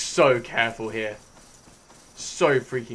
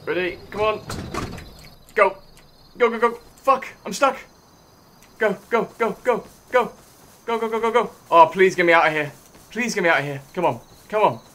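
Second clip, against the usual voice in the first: urgent pleading; 7 semitones higher, 4 dB louder than usual. Real, not acted. Panicked escape from noisy spot.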